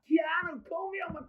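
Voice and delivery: high mock drunken yell; squeaky retold party shout. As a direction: imitate a drunk shouting the catchphrase. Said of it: "hearing someone shout that".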